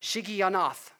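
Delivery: "Shigionoth" carefully sounded out in Hebrew; a careful try at Hebrew "Shigionoth"; Hebrew pronunciation. Shown as a demonstration. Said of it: "try my best to pronounce it in Hebrew".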